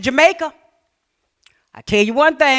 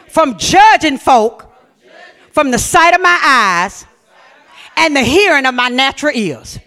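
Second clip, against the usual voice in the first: slow, fervent, louder prayer line; leading a prayer; 2 semitones higher, 7 dB louder, slower than usual. Shown as a demonstration. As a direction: lead the church in a slow spoken prayer. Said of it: "Put your hand on your heart. Say".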